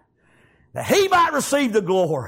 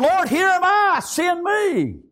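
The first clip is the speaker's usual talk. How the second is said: high, ringing cry to heaven; fervent calling out; 10 semitones higher, slower than usual. Acted. Preacher as Isaiah volunteering to God, fervent cry. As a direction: call it out as a preacher voicing a prophet. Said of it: "Isaiah said".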